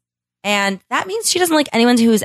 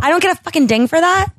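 indignant; 7 semitones higher, faster than usual. Real, not acted. Demanding a sound effect.

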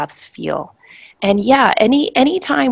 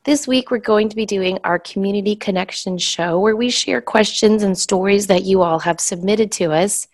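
ordinary delivery

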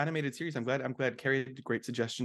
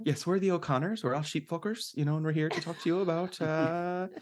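light stage Irish lilt, raised pitch; Irish accent; 5 semitones higher than usual. Acted. Host plays an Irish landowner family, in a light Irish lilt. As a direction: play a chirpy Irish family selling land. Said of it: "It's a really good Irish accent".

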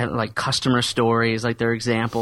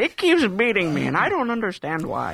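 put-on old man voice; 8 semitones higher than usual. Continues the old man bit.

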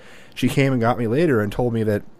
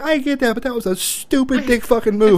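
high-pitched mock scold; retold angry scolding; 12 semitones higher than usual. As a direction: imitate dad calling it a stupid move. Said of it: "He's like".

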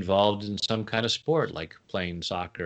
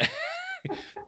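high laugh; 8 dB quieter than usual. Real, not acted. Laughing at her own mock panic.

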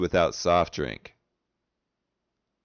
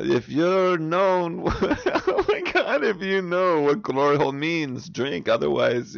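high, halting attempt at German, breaking into laughter; struggling mock German, pitched up; German accent; 6 semitones higher than usual. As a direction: read it in a badly faked German accent. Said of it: "speak with your best German accent".

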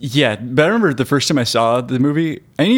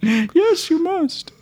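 high prim voice; 13 semitones higher, slower than usual. Acted. Acted wink dialogue.

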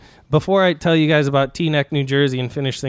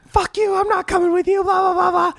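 very high mock shrieky rant; losing it. Friend refusing the star's invitation, mocked as high-pitched ranting. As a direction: imitate her tirade in a squeaky high voice, trailing into blahs. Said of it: "she fucking lost it".

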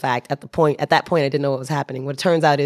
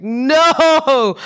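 sudden high, loud cry of dismay; a mock-horrified yelp; 10 semitones higher, 5 dB louder than usual. Teller reliving the moment the drunk heads her way, loud.

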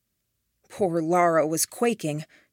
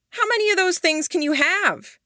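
challenging; 12 semitones higher than usual. Acted. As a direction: call his bluff. Challenging Sylvester.

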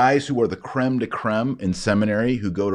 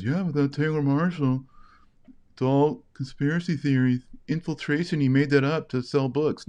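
critics' dismissive voice, pitched higher; mocking critics; 7 semitones higher than usual. The host mocking critics who call his claims conspiracy theory, dismissive sneer. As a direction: scoff like a smug skeptic who waves it all off. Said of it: "Everybody says".

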